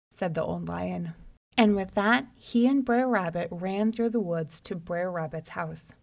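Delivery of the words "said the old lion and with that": ordinary delivery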